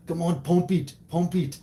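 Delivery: high, loud muscleman exhortation; strained Austrian-accented bellow; Arnold Schwarzenegger. Shown as a demonstration. Boss making his lifting crew laugh, shouted action-hero impression. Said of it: "I do a great Arnold Schwarzenegger impression".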